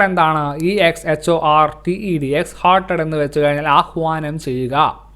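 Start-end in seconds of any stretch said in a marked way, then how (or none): none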